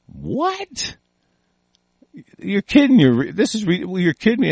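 high disbelieving what, then you're kidding; incredulous. Retold or imitated. Imitating a stunned reader, high incredulous disbelief. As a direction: act stunned by something absurd. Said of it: "you're gonna look at it and you go".

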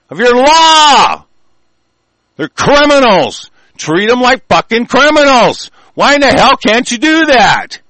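high, loud, slowing on law, then hammering on; angry shouting. Real, not acted. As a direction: yell it at the people who won't act. Ranting at listeners about their rights.

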